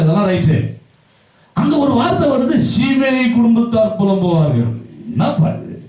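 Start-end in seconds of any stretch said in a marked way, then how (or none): 1.6-4.7 high-pitched preacher's cry, falling at the end; impassioned proclamation. Acted. Preacher revealing that Shimei's family will lament, impassioned. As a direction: proclaim the verse at full force.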